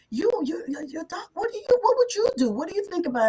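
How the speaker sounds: mock-pestering voice, pitched high; hammed-up needling therapist asking Doc again and again; mocking the counselor. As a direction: parody of a counselor pushing for expert advice. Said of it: "every session was like".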